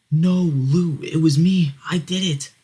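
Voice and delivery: high, loud desperate cry; acted frantic protest; distraught plea. Jeff tearfully protests brother's confession.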